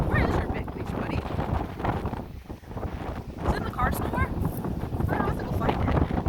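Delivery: high-pitched pet voice asking the dog; dog talk. Real, not acted. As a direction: coo up high at your puppy. Talking to the dog.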